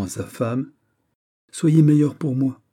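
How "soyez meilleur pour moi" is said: higher, louder, bitter reproach; resentful plea with anger held under; bitter, veiled anger. A narrator's reading. Prince reproaching his wife before guests, bitter veiled anger. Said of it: "avec une amertume qui voilait sa colère".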